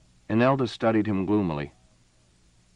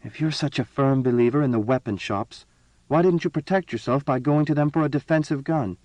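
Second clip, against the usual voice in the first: bitter; 6 semitones higher than usual. A narrator's reading. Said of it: "Then she said bitterly".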